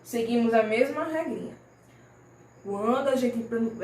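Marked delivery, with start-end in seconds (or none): none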